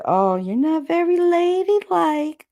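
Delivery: put-on high, slow prissy old aunt voice; Aunt Marie. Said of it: "That was my Aunt Marie".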